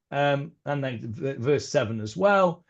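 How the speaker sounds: usual speaking voice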